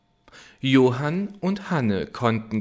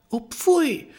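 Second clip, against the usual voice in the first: put-on much higher, louder girlish cry; girl's shocked cry; 4 dB louder than usual. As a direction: squeal it as the startled girl. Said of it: "rief Hanne". (hoher, empörter Mädchenschrei)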